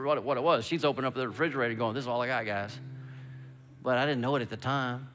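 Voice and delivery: ordinary delivery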